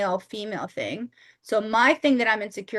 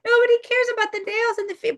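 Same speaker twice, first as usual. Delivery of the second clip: mock high squeal; 13 semitones higher, 5 dB louder, faster than usual. Mock complaint about nails.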